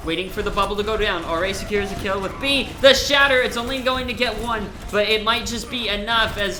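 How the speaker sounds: loud, high, excited fight call; live esports play-by-play. Real, not acted. Caster calling Kairos charging through, excited shout. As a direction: drive the action at full volume.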